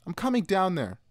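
high-pitched call. A narrator's reading. Sister calling to brother near the silo, calling out.

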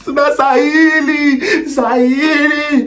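high, drawn-out hammed-up sobbing wail; mock shower crying. Parodying a sad-movie shower scene, hammed-up sobbing wail. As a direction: wail the names like a fake movie cry in the shower. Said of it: "when they cry in the shower".

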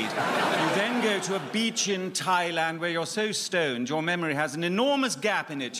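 ordinary talk, nothing marked

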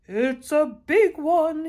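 slow, high, very loud shout; excited shout. A narrator's reading. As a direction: shout it down the street, stretching the words. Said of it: "he shouted".

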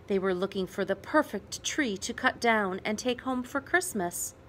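ordinary delivery, nothing marked

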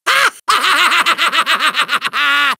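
long rapid villain laugh; evil cackle. Acted. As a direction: cackle gleefully in a staccato run. Villain gloating.

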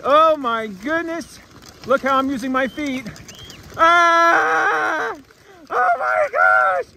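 excited screams while sliding fast; sledding downhill. Real, not acted. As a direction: shriek and laugh as the sled speeds.

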